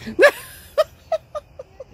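high, loud burst of laughter with a sob in it; loud delighted laugh. Real, not acted. She laughs wildly at the reunion with her friends. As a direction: laugh so hard it almost cracks.

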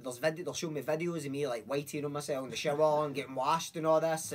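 ordinary delivery

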